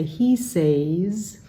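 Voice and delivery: "says" with a long ay like "sayz", a typical Indonesian learner error; Indonesian learner mispronunciation. Retold or imitated. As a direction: imitate the common mistake, "says" as "sayz". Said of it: "Many Indonesians read that and say".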